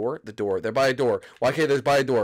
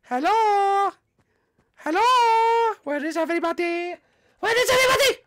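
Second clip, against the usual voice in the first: much higher call into the dark; creepy calling out; 4 dB louder than usual. Acted. Animatronic calling for hiding players, high.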